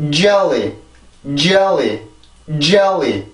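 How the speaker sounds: JELLY in English, each take falling; English pronunciation. Shown as a demonstration. Teacher reads it again before moving on, English pronunciation. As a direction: model JELLY with exact English tongue placement. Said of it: "다시 읽어보고 넘어갈게요".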